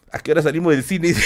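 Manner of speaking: a playful throwaway character line; mock voice. A silly aside joking about leaving the cinema, quick put-on voice.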